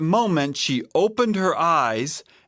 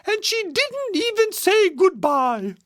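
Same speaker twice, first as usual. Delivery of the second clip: very high-pitched mother bear; indignant Mama Bear. A narrator's reading. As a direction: chime in high and offended. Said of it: "said Mama Bear".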